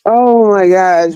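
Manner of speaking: ordinary talk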